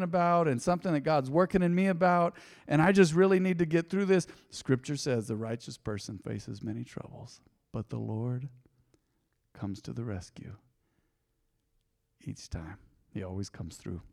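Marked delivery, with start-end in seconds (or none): 4.5-14.0 much softer, fading to near hush; quietly comforting the weary listener; soft reassurance. Shown as a demonstration. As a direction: lay it gently on a hurting heart. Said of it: "Now let's hesitate there for a moment".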